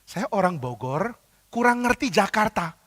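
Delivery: soft, slow plea rising high; meek mock excuse, drawn out; feigned innocence. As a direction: play the clueless out-of-towner. Playing innocent to the policeman.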